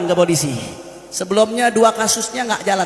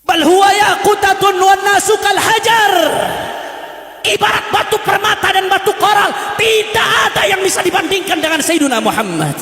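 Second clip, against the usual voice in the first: fiery preaching; 7 semitones higher than usual. Acted. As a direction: preach it at full force to the crowd. Preaching at full force. (ceramah berapi-api dengan suara tinggi dan keras)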